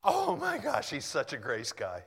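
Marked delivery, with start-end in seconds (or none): 0.0-0.9 high, loud burst of amazement; marveling outburst. Real, not acted. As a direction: break off, overwhelmed with wonder. Breaks off reading to marvel.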